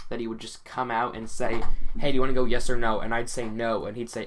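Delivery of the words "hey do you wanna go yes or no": dad's loud quick call up the stairs; brisk loud call from the office. Pretend. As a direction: call out loud and fast to get a straight answer. Imagined dad calling her out.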